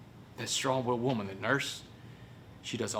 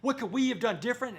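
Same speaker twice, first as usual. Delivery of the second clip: high, anguished plea of a crying father; retold crying; 11 semitones higher than usual. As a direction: wail it in despair, voice pitched way up. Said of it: "now he's crying".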